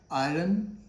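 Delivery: 'iron' as eye-run, R voiced; R sounded. Shown as a demonstration. Teacher asks learners, mistaken R sounded. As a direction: say 'iron' wrongly with an r before the vowel. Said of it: "It is again wrong".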